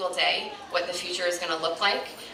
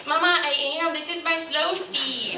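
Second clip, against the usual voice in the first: son's voice; 9 semitones higher than usual. Retold or imitated. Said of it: "And he was like".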